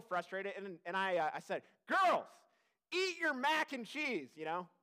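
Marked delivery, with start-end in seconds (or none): 1.9-4.3 firm, raised, slowed-down parental order; harder dad tone. Retold or imitated. Frustrated dad telling his girls to eat, in a harder tone. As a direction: lean on it like a dad losing patience. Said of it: "Not quite that intense, but I got a little harder tone".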